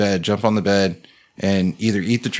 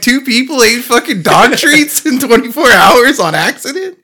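high, loud, laughing through the words; can't believe it, cracking up; laughing disbelief; 13 semitones higher, 8 dB louder than usual. Real, not acted. Disbelief over dog treats.

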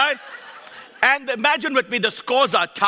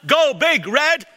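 team cheer; 7 semitones higher, 4 dB louder, slower than usual. Real, not acted. Cheering Nebraska team chant.

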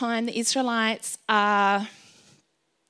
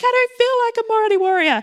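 loud, much higher mock wail; acted protest; 12 semitones higher, 7 dB louder than usual. Speaker answering God's call, high-pitched protesting wail. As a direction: protest in an exaggerated high cry.

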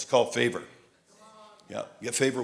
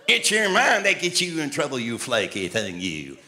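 Norval Hayes; 4 dB louder, slower than usual. Retold or imitated. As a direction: scold in a drawn-out Southern drawl. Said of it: "You remember Norval?".

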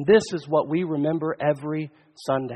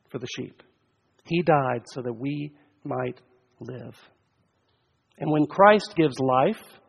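ordinary talk

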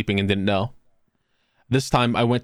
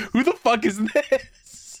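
high, drawn-out incredulous question; hammed-up bewildered demand; mock bafflement; 11 semitones higher, much slower than usual. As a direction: stretch it out slowly in put-on confusion. Imagines reacting to random message.

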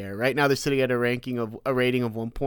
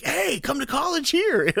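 much higher, slow, sing-song recruiter pitch; mock eager recruiter; 12 semitones higher, slower than usual. The college scout lures the army veteran, in a high sing-song recruiter voice. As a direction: play a college scout sweet-talking a recruit. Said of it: "I had people, like, scouting me".

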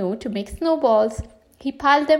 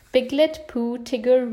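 usual speaking voice

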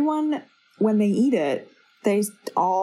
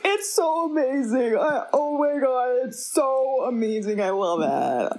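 gushing diners; 4 semitones higher than usual. Retold or imitated. Diners raving, acted voices.

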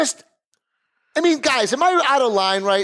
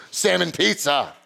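ordinary talk, nothing marked

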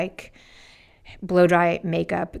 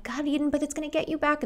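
scolding inner voice; 8 semitones higher, faster than usual. Retold or imitated. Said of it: "I'm like".

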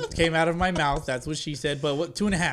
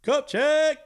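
high, loud hype announcer call; 11 semitones higher, 4 dB louder than usual. Pretend. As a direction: shout it like a hype man. Announcing cup check segment.

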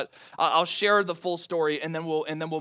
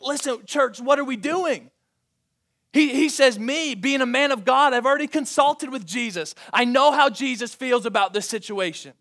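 louder and higher, an exasperated apostle scolding the church; stern rebuke; 7 semitones higher, 7 dB louder than usual. Acted. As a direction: scold them like a fed-up father. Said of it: "a little bit of tone in Paul's voice".

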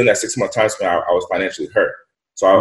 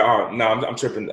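ordinary talk, nothing marked

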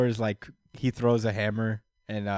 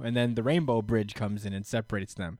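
ordinary talk

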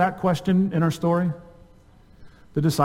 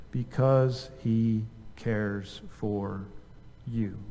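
very slow, softer and lower; hushed, lower pastoral comfort, word by word; tender reassurance; 5 semitones lower, 8 dB quieter, much slower than usual. Real, not acted. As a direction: let each word land gently. Preacher reassures congregation from scripture.

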